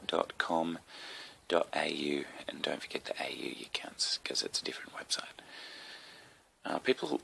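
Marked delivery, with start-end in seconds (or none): 2.5-5.4 softer whispered "And don't forget the A U"; whispered aside. Real, not acted. Podcast host whispering his web address tip to listeners. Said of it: "keep asking why I keep saying".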